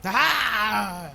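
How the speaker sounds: put-on loud, hearty old sailor; gruff old fisherman. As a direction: boom it out like an old seadog. Old sailor friend greets.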